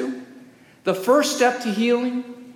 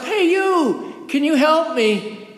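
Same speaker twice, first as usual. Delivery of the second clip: higher-pitched mild shout from below; mock call for help; 7 semitones higher than usual. Man in a hole hailing a passing doctor, mock shout. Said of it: "passes by and shouts up".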